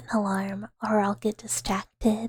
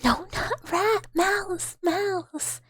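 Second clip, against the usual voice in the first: higher, squeaky insisting voice; squeaky mouse protest; 8 semitones higher, much slower than usual. Pretend. Streamer insisting to chat she is a mouse. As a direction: squeak like an offended little mouse.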